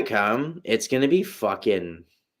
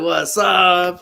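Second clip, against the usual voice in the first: raised, louder hail; acted-out greeting; 7 semitones higher, 6 dB louder than usual. Retelling how he greeted a celebrity, raised hailing voice. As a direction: call it out like greeting someone across a room. Acting out his greeting to someone.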